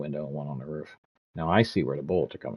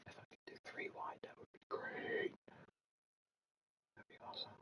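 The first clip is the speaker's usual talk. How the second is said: much softer whispered aside; 20 dB quieter than usual. Real, not acted. Planning the room setup, whispered under his breath. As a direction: breathe it to yourself as a private thought. Muttering to himself about desk.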